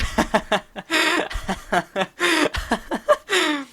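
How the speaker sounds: laughter rolling on; real giggling fit; high laugh. Real, not acted. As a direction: keep cracking up high. Still laughing at prank.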